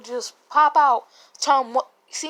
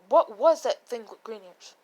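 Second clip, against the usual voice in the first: normal talking voice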